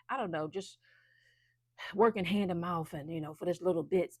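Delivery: ordinary delivery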